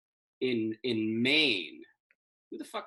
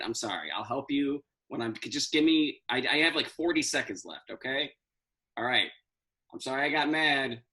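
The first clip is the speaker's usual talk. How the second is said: ordinary talk, nothing marked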